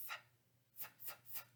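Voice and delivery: "f" as a soft breathy fff, no vowel; F sound. Shown as a demonstration. Teacher sounding out F for kids. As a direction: blow a soft unvoiced fff. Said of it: "that's what F says".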